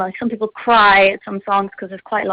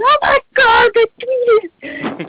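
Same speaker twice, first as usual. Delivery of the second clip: very high-pitched, squealing fangirl scream; high, drawn-out mock squeal of starstruck delight; much slower than usual. Shown as a demonstration. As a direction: re-enact shrieking round the bedroom in excitement. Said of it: "I'm doing the screaming around the bedroom".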